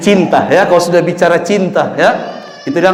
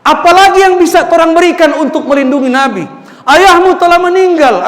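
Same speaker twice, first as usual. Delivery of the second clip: impassioned mother; 10 semitones higher, 4 dB louder than usual. Acted. Mother's impassioned question to son.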